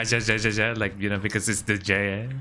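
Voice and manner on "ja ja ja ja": "ja" said with a rasped Spanish j; ja ja ja with a throaty j sound; Spanish laugh ja. Shown as a demonstration. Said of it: "would pronounce it as ja".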